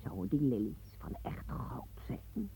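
low, slow, covetous whisper; a hushed whisper full of greed; greedy whisper. Acted. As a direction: breathe it while eyeing the gold. Eyeing the gold lilies greedily.

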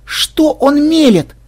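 high, slow and horrified. A narrator's reading. Melissa, horrified as Ksen flatters the witch. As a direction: gasp in disbelief at his words. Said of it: "в полном ужасе прошептала Мелисса".

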